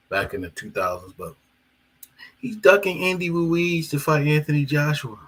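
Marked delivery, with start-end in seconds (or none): 2.4-5.2 higher mocking voice of the doubters; lightly imitating the fans; mock critic. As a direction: parrot the critics. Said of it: "That's what people are saying".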